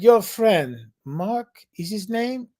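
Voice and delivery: lightly imitated deadpan Austrian guide; a hint of a flat foreign guide asking calmly; matter-of-fact guide. Austrian guide asks about the climbing friend, mild deadpan. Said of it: "matter of fact, factly".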